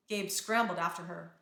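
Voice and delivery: ordinary delivery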